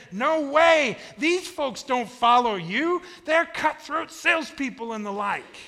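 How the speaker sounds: loud, high-pitched objection; acted protest. Preacher as younger self refusing God's call, loud protest.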